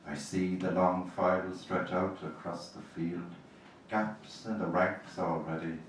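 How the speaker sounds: slow, grave elegy reading; poetry reading. Acted. Poet reads an elegy for her dead to the audience, gravely.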